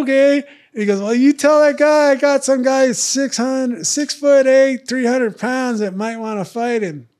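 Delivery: high, whiny nasal-free whine; mock Art's whiny little voice. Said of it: "Art's got this whiny little voice".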